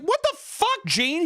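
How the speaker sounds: hammed-up shock; mock outraged wife. Anne after the dead leg, high mock shriek. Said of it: "She's like".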